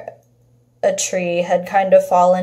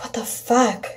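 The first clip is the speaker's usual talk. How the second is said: whispered shock; 9 dB quieter than usual. Retold or imitated. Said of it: "we're like".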